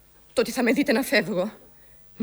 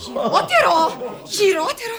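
higher and louder, laughing through the word; mocking laugh; 7 semitones higher, 6 dB louder, slower than usual. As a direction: scoff at it with a laugh. (κοροϊδευτικό γέλιο)

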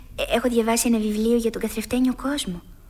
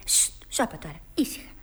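soft low shushing; hushing; 7 semitones lower, 6 dB quieter than usual. Acted. Alice quieting the kitten, low shushing. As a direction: hush the kitten down.

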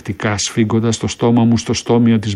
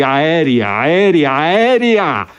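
rising called warning, swelling; alarm call; 2 semitones higher, much slower than usual. A narrator's reading. Soldiers calling the gas alarm between explosions, rising shout. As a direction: cry the alarm across the trench.

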